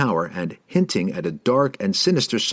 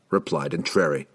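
plain reading voice

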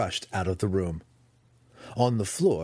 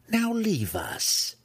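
high, drawn-out condescending dismissal; haughty matriarch, pitched high; 13 semitones higher, much slower than usual. Acted. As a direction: dismiss them like underlings, slowly. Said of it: "Malice continued condescendingly".

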